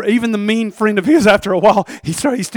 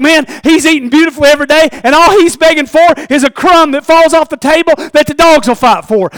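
envious marvel; 9 semitones higher, 10 dB louder than usual. Acted. Lazarus watching the rich man.

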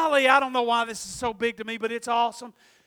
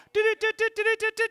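high, rapid teletype noise; imitated news ticker; 10 semitones higher, much faster than usual. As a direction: rattle off a news-wire beeping. Mimics news wire sound before announcement.

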